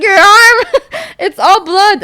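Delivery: loud, high cry through laughter; mimicked alarm. Cop spotting the bloody arm, hammed-up alarmed cry. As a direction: imitate a horrified officer, high and loud.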